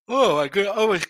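groaning through a yawn. Real, not acted. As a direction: let the yawn take over the words. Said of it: "I'm yawning here".

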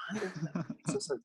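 high, soft put-on voice, rising further on the second line; a bewildered little kid, almost under his breath; confused kid. Kid puzzling over mom's hockey logic, high and hushed. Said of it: "I'm like".

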